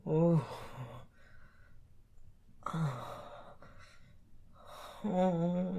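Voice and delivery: loud drawn-out pleasure moan. Acted. Loud moans in erotic scene.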